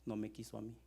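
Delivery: low, soft, with pauses; God's quiet hurt. Acted. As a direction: let the rejection sink in, quietly. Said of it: "Y dice Dios". (Dios dolido, en voz baja y grave)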